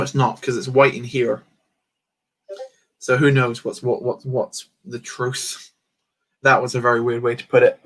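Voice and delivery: normal talking voice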